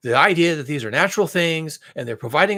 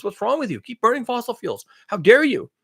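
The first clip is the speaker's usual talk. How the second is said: higher-pitched mock scolding; fossil fuel industry; 6 semitones higher, 4 dB louder than usual. Industry scolding fossil fuel opponents, mock outrage. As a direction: build to pretend outrage. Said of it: "getting from the fossil fuel sector".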